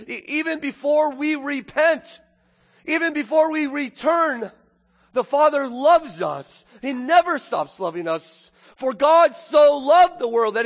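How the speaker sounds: high, loud fervent preaching; preaching at full force to the congregation; impassioned preaching. Real, not acted. Preacher tells the church the Father loves them, impassioned.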